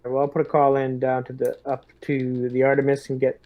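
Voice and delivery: ordinary talk, nothing marked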